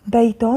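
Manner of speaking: بيت read with an added -un ending; tanween damm. Shown as a demonstration. Teacher reading out the word list, tanween damm on بيت. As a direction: sound out بيت as "baytun". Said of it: "ضم".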